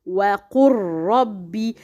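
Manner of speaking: "وقل ربي" with ل silent, merged into a long rolled ر; merged lam. Shown as a demonstration. Teacher showing a merged lam, doubled r. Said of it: "Here we cannot pronounce lam because it is merged".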